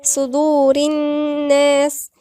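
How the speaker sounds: slow, drawn-out recitation of صدور الناس; ghunnah recitation. Shown as a demonstration. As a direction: hold a nasal hum on the ن of الناس. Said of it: "ونغن في النون".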